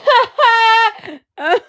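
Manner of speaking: high, loud laugh breaking into a cry; laughing through the pain; cry-laugh shriek. Real, not acted. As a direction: burst into a shrieking half-sob laugh. Laughing so she doesn't cry.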